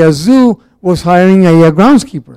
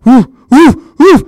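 higher, louder ape hooting; gorilla hoots; 7 semitones higher, 4 dB louder, slower than usual. Retold or imitated. Guy in gorilla suit hooting at zoo crowd, ape hoots. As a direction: hoot like a gorilla pounding its chest. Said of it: "this guy's like".

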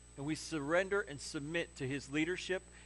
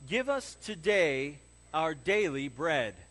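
slow, firm, louder, every word spaced; slow unison reading; 2 semitones lower, 4 dB louder, slower than usual. Shown as a demonstration. Pastor leads church in unison reading, slow and firm. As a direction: lead the congregation through the verse slowly. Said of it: "Say that with me".

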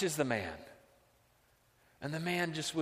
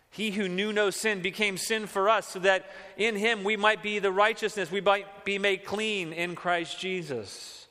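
loud, rising sermon proclamation; fervent pulpit voice; preaching at full force; 7 semitones higher, 6 dB louder than usual. Acted. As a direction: proclaim it to the church with fervor.

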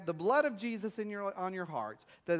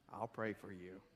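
much lower and softer, a hollow pious mumble; mock pious murmur; 13 semitones lower, 14 dB quieter than usual. Preacher mimics almost Christians' empty promise, murmured. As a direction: toss off the stock prayer promise. Pastor mocks pious stock reply.

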